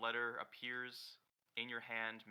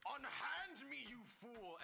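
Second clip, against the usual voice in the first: high-pitched mock outrage; hammed-up, high indignant protest; indignant outcry; 14 semitones higher, slower than usual. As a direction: cry out like an affronted hero. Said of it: "you walk up and you're like".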